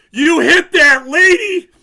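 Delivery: slow, high, loud disbelieving yell; incredulous shout. Retold or imitated. Referee yelling at the player who hit a lady, incredulous shout. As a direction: shout it at him in disbelief across the ice.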